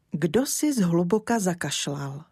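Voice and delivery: ordinary delivery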